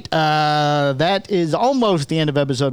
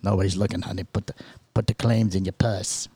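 conspiratorial hush; 8 semitones lower, 5 dB quieter than usual. Acted. Couple sneaking goods, voiced.

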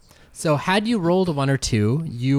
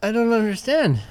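confused goblin; 9 semitones higher than usual. Acted. Goblin NPC voiced by GM.

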